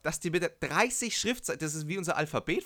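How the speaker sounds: high, loud and amazed; blurting out in disbelief; excited. Real, not acted. Amazed about squid writing.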